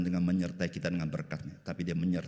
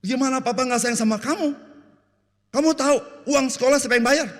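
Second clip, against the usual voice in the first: high, loud, exasperated dad voice; an offended father shouting back at his child; hammed-up angry father; indignant father; 13 dB louder than usual. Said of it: "Papa bilang gini".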